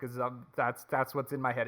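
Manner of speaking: normal talking voice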